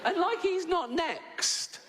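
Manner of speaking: high, slow, put-on squeak; lightly squeaky, drawn out; mock whining high voice. Retelling a hell anecdote.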